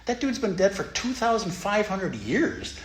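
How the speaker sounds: sarcastic disbelief at a Dharma talk; incredulous retort. Retold or imitated. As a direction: scoff at the idea like it's absurd. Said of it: "I'm like".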